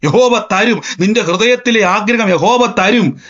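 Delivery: slow, emphatic proclamation opening; preacher prophesying with conviction; prophesying declaration. Shown as a demonstration. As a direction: declare it over yourself in the mirror. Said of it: "കണ്ണാടിയിൽ നിൽക്കുന്ന വ്യക്തിയോടൊന്ന് പ്രവചിക്ക്".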